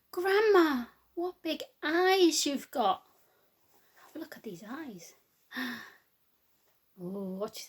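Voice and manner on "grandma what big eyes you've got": higher, slow, drawn-out wonder; wondering little girl. Acted. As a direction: marvel at it slowly like a child.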